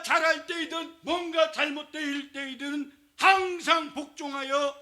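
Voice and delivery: high, loud proclaiming; fiery scripture reading. Real, not acted. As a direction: proclaim the verse to the whole church. Scripture read at full force.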